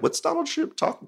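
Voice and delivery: a squeaky send-up of confused critics; mock puzzled voice. Left-wing people puzzled by Trump's tweet, high mocking voice.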